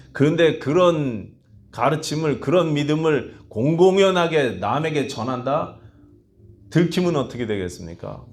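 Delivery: full-force preaching. Acted. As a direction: preach it with rising fervor. Preaching the early church's risk. (힘있게 외치는 설교 목소리)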